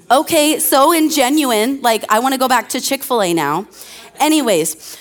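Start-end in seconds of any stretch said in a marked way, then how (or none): none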